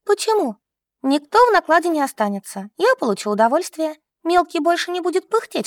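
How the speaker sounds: put-on high-pitched, carefree girl character; brazen younger sister. A narrator's reading. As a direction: argue it airily, up high. Younger sister brazenly argues her plan. (беспечная младшая сестра, высоко)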